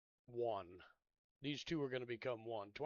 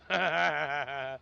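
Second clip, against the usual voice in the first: a real burst of loud laughter; 9 dB louder than usual. Real, not acted. Laughing at the game while talking to viewers. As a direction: laugh out loud at something funny on screen. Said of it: "That's funny, C C".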